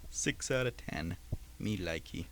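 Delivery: usual speaking voice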